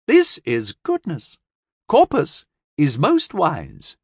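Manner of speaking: high, slow earnest alien; high, slow, reverent alien. Acted. Alien speaks slowly.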